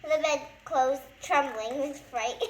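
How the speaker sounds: plain reading voice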